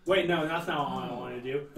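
laughing. Real, not acted. Player laughs at own mistake.

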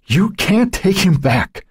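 high, slow, shrieking scream. Acted. Joan screaming at her sister in raging fury. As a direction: scream it at your sister in rage. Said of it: "she screamed".

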